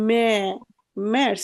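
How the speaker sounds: ম্যার্স built from an open "me" sound; umlaut pronunciation. Shown as a demonstration. Teacher answers students asking about A-umlaut, broken-down pronunciation. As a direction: sound out মে, then complete ম্যার্স. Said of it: "আ উমলাউটের উচ্চারণ কেমন হয়".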